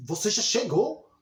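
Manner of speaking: surprised exclamation, rising on "chegou"; admiração encenada. Shown as a demonstration. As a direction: say it as if amazed they have arrived. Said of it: "como se eu estivesse me admirando". (tom de admiração, subindo em "chegou")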